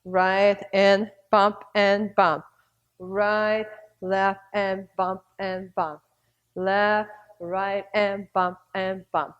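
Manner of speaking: slow, rhythmic call of the steps; slow rhythmic step cue; slow dance cue. Shown as a demonstration. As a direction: call the moves on the beat for the class. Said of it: "repeat your figure eight from left to right".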